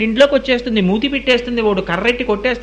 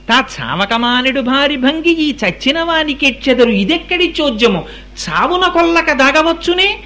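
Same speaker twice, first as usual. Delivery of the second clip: slow chant lifting higher toward the end; poem recitation; 4 semitones higher than usual. Acted. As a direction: intone the verse, then land it emphatically. (పద్యం రాగంతో, చివరికి స్వరం పెంచి)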